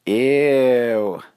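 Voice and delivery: long drawn-out ew; loud, grossed-out child; disgusted. Acted. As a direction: draw it out in disgust. Girl disgusted by bear lick.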